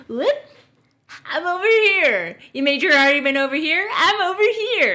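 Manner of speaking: high, loud mocking squawk; mock smug host. Mocking the podcast host, high loud squawk. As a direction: ham up a smug bro. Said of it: "And then he's like".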